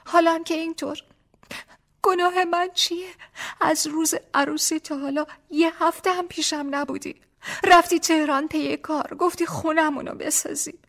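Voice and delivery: put-on a young wife's voice, high and hurt, pleading. A narrator's reading. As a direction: pour out her grievances to her husband. Wife's long complaint.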